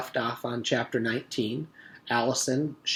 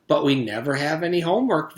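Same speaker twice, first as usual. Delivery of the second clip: cheerful; 5 semitones higher, 7 dB louder than usual. Acted. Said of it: "Virginia said cheerfully".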